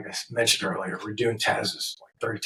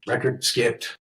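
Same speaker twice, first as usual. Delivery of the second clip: ordinary talk, nothing marked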